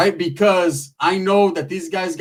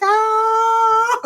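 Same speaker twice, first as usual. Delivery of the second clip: high-pitched Chow-style cackle. Retold or imitated. Chow laughing after his line, high cackle. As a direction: cackle like the Hangover gangster. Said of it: "Mister Chow".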